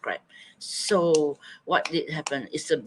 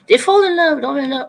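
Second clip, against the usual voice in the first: excited; 10 semitones higher, 10 dB louder, faster than usual. Real, not acted. Reading out chat suggestions.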